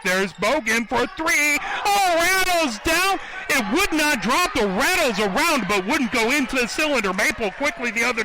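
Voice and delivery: high excited play-by-play call. Real, not acted. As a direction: rise up sharply as the shot rattles out. Missed three-pointer, commentator reacts.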